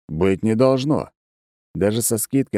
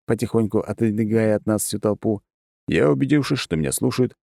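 usual speaking voice

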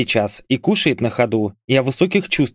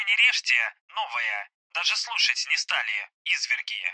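soft, higher, thin phone-filtered voice; voice on speakerphone; 4 semitones higher, 8 dB quieter than usual. Acted. Hospitalised soldier reporting to his comrades, telephone voice. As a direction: talk as if coming through a phone speaker. Bars heard over speakerphone.

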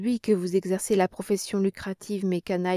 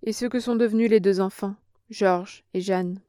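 usual speaking voice

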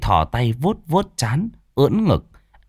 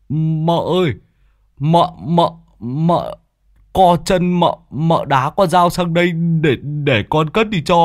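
higher, anxious coaxing plea; pleading old man; 7 semitones higher than usual. Acted. Sweaty old man begging the wife to put down the cleaver. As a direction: talk her into dropping the knife. Lâm Sẹo coaxes.

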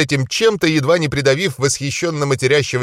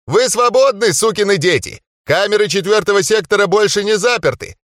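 grandiose announcement; 7 semitones higher than usual. Acted. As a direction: proclaim it with mock grandeur. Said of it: "пафосным тоном заявил в микрофон".

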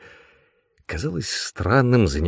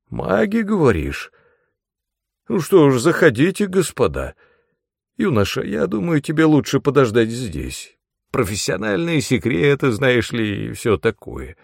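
put-on high-pitched, lively old man; airy, high old man's chatter; old mage; 5 semitones higher than usual. A narrator's reading. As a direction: play an old wizard, voice pitched up. Old mage voiced, receiving visitors.

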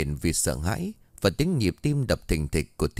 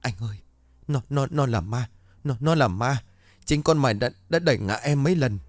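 higher-pitched, stuttering fright; acted panic, tripping over repeated words; frightened stammer; 4 semitones higher than usual. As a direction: stammer it out as if scared of a ghost. Terrified woman stammers about a ghost.

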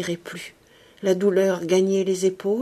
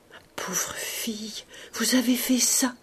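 put-on a dying man whispering softly to his old maid; weak whisper; 4 semitones higher, 3 dB quieter than usual. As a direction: whisper it as if barely able to breathe.

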